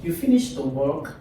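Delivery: "You finished the work" turned into a question by a rise at the end; rising question. Shown as a demonstration. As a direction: voice climbs on "work" to ask. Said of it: "my voice goes up".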